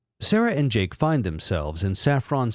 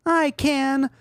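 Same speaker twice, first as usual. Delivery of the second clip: very high defiant falsetto; Sarah voice; 14 semitones higher than usual. Acted. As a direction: squeak the challenge.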